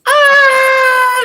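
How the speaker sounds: piercing high scream; crowd screaming. Acted. Townsfolk screaming as the monster attacks. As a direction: scream in terror. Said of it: "everybody goes".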